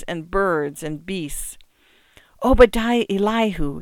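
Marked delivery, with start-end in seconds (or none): none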